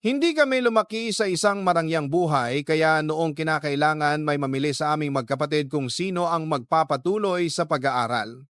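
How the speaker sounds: ordinary talk, nothing marked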